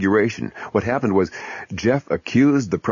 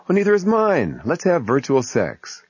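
woman voice; 8 semitones higher than usual. Acted. Voicing woman in skit.

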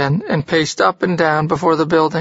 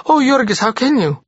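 frightened plea; 8 semitones higher than usual. Acted. Wife pleads, frightened.

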